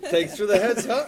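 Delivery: loud, high laughing quip; laughing joke. Acted. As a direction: toss it off mid-laugh. Joking reply, laughing.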